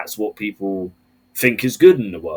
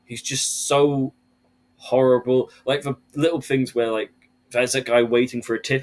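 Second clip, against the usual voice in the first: ordinary talk, nothing marked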